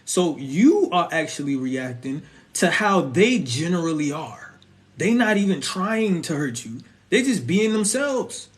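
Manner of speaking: normal talking voice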